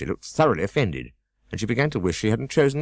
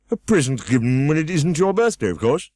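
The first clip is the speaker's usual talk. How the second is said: higher, louder pompous scold; Humpty Dumpty scolding; 8 semitones higher, 5 dB louder than usual. A narrator's reading. Humpty Dumpty scolding Alice, higher and louder. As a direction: snap at the girl from the wall.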